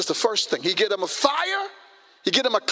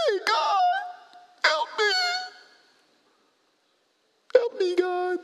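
very high-pitched mock cry for help; hammed-up plea; 12 semitones higher than usual. Moses calling to God before the army, comically pleading. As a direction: plead in a thin, exaggerated high voice.

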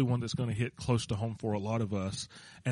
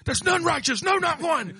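much higher, louder quoting of scripture; mock preacher quote; 5 dB louder than usual. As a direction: belt out the verse in a put-on preacher voice.

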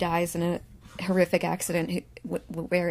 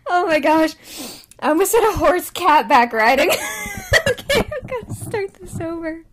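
high, loud words breaking into giggles; real laughing fit; 10 semitones higher, 5 dB louder than usual. Real, not acted. Podcaster flubbing horseback riding on a retake, helplessly laughing. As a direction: talk through helpless laughter, pitch way up. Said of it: "get through this without laughing".

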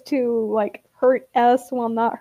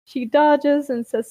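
usual speaking voice

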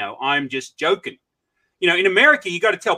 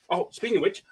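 normal talking voice

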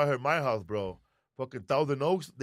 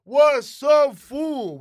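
big shouted show opener; loud greeting; 9 semitones higher, 12 dB louder, much slower than usual. Real, not acted. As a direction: call it out loud to the room.

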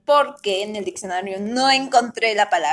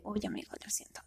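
whispered; 20 dB quieter than usual. Retold or imitated.

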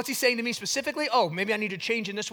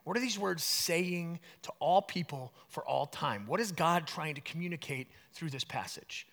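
quiet reflective asking; 2 semitones lower, 7 dB quieter than usual. Retold or imitated. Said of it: "But come at it and say".

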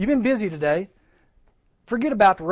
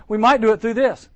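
emphatic; 5 semitones higher, 6 dB louder than usual. Shown as a demonstration. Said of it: "This big letter that I get".

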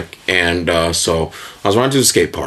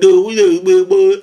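high-pitched mocking imitation; exaggerated high mock protest; mocking quote; 10 semitones higher, 4 dB louder than usual. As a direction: mimic the objection in a high voice. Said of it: "And you said".

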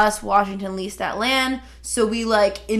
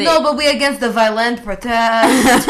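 laughing mock Russian accent, louder; mock Russian accent through a laugh; playful fake Russian accent; 2 semitones higher, 5 dB louder than usual. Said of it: "only in a Russian accent".

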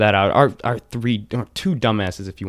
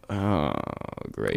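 resigned dismay; 11 semitones lower, 7 dB quieter than usual. Retold or imitated. Reaction to giving dad's number.

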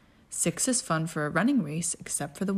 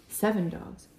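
ordinary delivery, nothing marked

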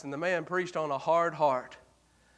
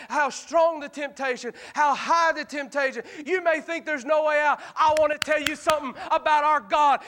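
higher, full-force preaching; preaching altar call; 11 semitones higher than usual. Acted. Preacher urging sinners to repent tonight, full force. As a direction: press the lost to come tonight.

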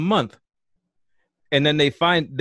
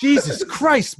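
exasperated shout; 13 semitones higher, 4 dB louder, slower than usual. Acted. Alien boss yelling at Brian.

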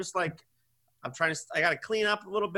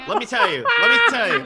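higher, louder exasperated build; wife winding up to vent, getting louder; 14 semitones higher, 8 dB louder, faster than usual. Real, not acted. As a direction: ramp up to a rant. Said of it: "I just need a vent".

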